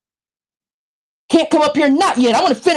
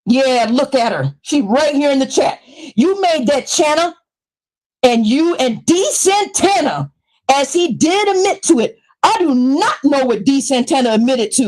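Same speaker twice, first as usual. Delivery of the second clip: usual speaking voice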